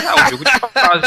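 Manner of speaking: high, loud kid imitation; know-it-all kid voice. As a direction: mimic a smug schoolkid.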